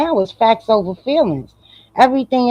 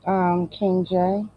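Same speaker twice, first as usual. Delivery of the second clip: muffled; 3 semitones lower, 7 dB quieter, much slower than usual. Real, not acted. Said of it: "You sound muffled".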